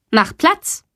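high, stern order to a dog; stern command. A narrator's reading. Teacher orders Sally to lie down, stern call. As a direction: give a stern command without shouting. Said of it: "blickt streng zu Sally und ruft".

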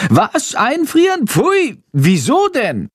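very high, shrill-pitched bat voice squealing in horror; horrified shriek. Acted. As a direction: shriek it out, appalled. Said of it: "kreischt Chandor entsetzt".

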